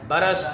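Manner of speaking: बरस as two syllables, "ba-ras"; short a after the r in बरस; correct pronunciation. Shown as a demonstration. Teacher correcting pupils, correct pronunciation. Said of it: "रे की ज़बर के साथ पढ़ना है".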